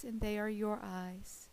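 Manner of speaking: usual speaking voice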